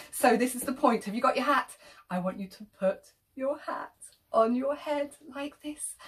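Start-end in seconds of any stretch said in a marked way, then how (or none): none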